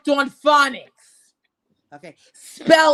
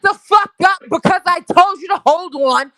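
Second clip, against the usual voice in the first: higher, much louder, furious yell at a viewer; angry shout; 8 semitones higher, 5 dB louder than usual. Real, not acted. Streamer yells at chatter M G O to hold on, real angry shout. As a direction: bark it at the chatter who won't wait.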